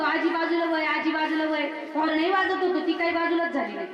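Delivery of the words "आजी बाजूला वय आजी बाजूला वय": driver calling out to granny to move; calling out to clear the road; mock shout. Tractor driver yelling at granny to move aside, calling out. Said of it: "तिला भरपूर ओरडत होतो".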